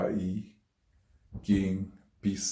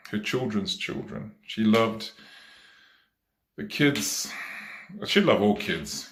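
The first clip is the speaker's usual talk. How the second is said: normal talking voice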